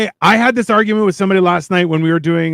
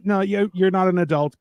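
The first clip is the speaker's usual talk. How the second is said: ordinary talk, nothing marked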